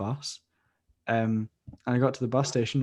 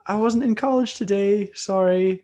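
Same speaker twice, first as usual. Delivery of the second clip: whiny plea; 8 semitones higher, 6 dB louder than usual. Retold or imitated. Quoting his own plea.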